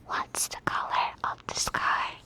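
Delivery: carefully whispered test question. Pretend. Doctor asks patient a silly question in the hearing test, whispering. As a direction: whisper it clearly, with no voice at all. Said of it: "whispering part".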